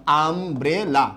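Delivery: Umbrella in three beats, আম, ব্রে, লা; syllable by syllable. Shown as a demonstration. Teacher recapping three syllables, pausing within umbrella. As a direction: pause after each syllable of umbrella. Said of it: "তিন জায়গার থামছি".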